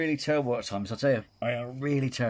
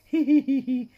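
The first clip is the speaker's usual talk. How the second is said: shrill hee-hee, much higher and louder; high mock giggle; 9 dB louder than usual. Imitating the laughing ghost, high and loud. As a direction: giggle like a spooky woman, way up high. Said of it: "she was laughing again".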